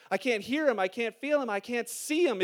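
normal talking voice

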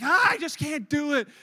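loud wail of a balking jumper, hammed up; mock panic. Girlfriend balking at cliff edge, mock-panicked wail. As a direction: burst out in imitated panic.

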